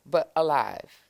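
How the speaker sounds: plain reading voice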